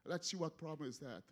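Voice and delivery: normal talking voice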